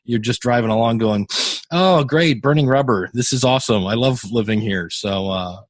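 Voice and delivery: normal talking voice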